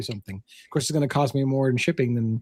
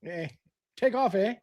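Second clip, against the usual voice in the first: slow, softer mock Canadian hoser; Bob and Doug McKenzie; 4 semitones higher, 6 dB quieter, slower than usual. Co-host trading catchphrases from a comedy duo, slow mock hoser. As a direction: draw out a Canadian brush-off in imitation. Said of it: "what about Bob and Doug McKenzie".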